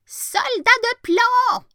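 high, slow, strange yelled call; jack-in-the-box yelling. A narrator's reading. Jack-in-the-box yells at the tin soldier, high slow shriek. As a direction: stretch the name out in a high shrieking yell. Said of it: "hurla-t-il".

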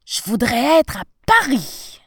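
loud, declaimed wish; big-breath wish. A narrator's reading. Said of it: "il aspira une grande bouffée d'air et dit".

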